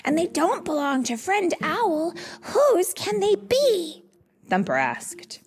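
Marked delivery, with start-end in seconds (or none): 0.0-4.0 high-pitched character puzzling it out; high, eager young-animal voice; excited chatter. A narrator's reading. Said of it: "chatted excitedly as they walked".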